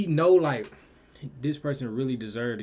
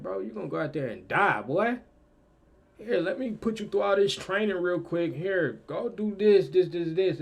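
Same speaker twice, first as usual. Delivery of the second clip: raised, forceful mock orders; commanding trainer; 5 semitones higher, 4 dB louder than usual. Trainer ordering an untested soldier through training, forceful and commanding. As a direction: push the recruit through drills.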